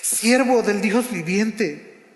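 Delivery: a lightly saddened call, drawn out slowly; sad voice. Acted. The king calling sadly to Daniel at the den, mild sorrow. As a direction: read the king's words with a touch of grief, unhurried. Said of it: "con voz triste".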